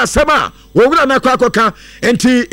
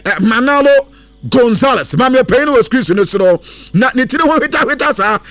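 ordinary talk

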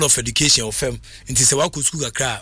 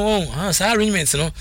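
ordinary talk

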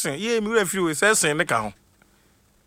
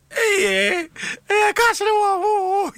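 squealing laugh; much slower than usual. Real, not acted. Squealing over a listener's comment.

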